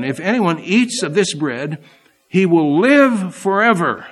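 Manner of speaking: loud, ringing pulpit reading of scripture; preaching at full force. Acted. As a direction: proclaim the verses to the whole congregation.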